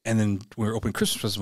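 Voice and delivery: ordinary talk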